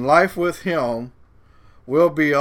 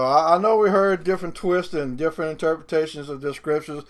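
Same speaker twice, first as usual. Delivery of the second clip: ordinary talk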